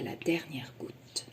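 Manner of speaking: soft slow whisper. A narrator's reading. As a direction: whisper it down to nothing. End of eating.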